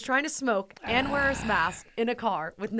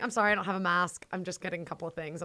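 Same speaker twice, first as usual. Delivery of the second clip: rushed apology; faster than usual. Retold or imitated. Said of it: "I'm like".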